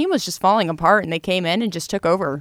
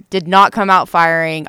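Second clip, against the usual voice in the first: usual speaking voice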